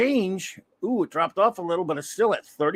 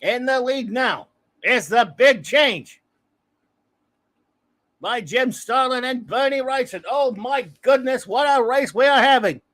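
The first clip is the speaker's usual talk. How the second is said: Howard Cosell sportscaster; 5 semitones higher, 4 dB louder than usual. Acted. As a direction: announce the new leader like a title fight. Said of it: "my stupid Howard Cosell".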